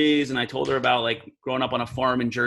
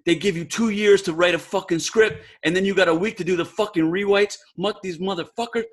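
higher New York street voice, griping under his breath; New York street talk; 4 semitones higher than usual. Retold or imitated. Impressions of Abel ranting about rewrites, in higher New York street talk. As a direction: do the old New York neighborhood guy cursing at his script. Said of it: "he kinda talks like, like bebop".

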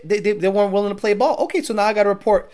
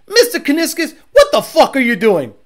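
high, loud mock outrage; Demonology confronting the boss; 5 semitones higher, 5 dB louder than usual. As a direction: call out the boss in pretend indignation. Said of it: "He's not gonna go".

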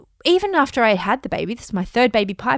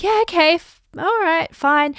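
reluctant yes; 8 semitones higher, 3 dB louder than usual. Retold or imitated. Reluctantly agreeing to TV show.

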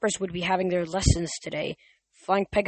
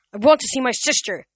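higher, louder, demanding; 6 semitones higher, 7 dB louder than usual. A narrator's reading. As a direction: insist on seeing her, hard. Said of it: "Nico demanded".

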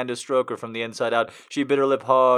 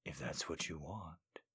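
low, soft, seductive murmur; 10 semitones lower, 24 dB quieter than usual. Acted. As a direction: murmur it low into her skin. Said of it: "he murmured against her skin".

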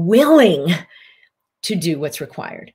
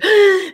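panicked gasp; 13 semitones higher, 6 dB louder than usual. Acted.

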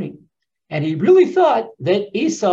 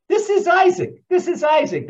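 much higher, louder emphatic cry; excited exclamation; 12 semitones higher, 4 dB louder than usual. Real, not acted. Preacher to his audience, excited emphatic cry. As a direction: proclaim it with amazement.

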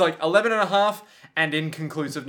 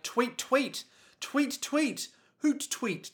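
higher, bird chirping words; parakeet chatter; 8 semitones higher, 4 dB quieter, slower than usual. Retold or imitated. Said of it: "is all like".

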